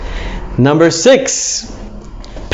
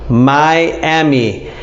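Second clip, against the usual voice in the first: ordinary talk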